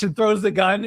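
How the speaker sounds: ordinary delivery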